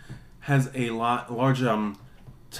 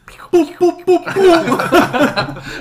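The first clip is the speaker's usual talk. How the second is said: high, loud boops; playful high-pitched boop noises; imitated booping; 9 dB louder, much slower than usual. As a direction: toot out loud falsetto boops.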